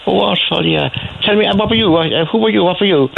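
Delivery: pry eagerly like a Cork local; nosy Cork woman. Retold or imitated. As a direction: Cork woman quizzing him, pitched high and keen. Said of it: "So I said".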